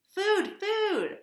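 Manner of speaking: very high, loud squeaky begging; tiny baby bird crying for food; hungry gosling peep. Acted. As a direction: squeak for food like a starving chick.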